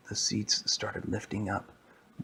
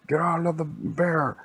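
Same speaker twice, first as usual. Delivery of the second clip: higher-pitched, forceful acted command; police robot's order; 7 semitones higher, 3 dB louder than usual. Giant police animatronic confronting the heroes, forceful raised voice. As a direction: order them out as a giant officer.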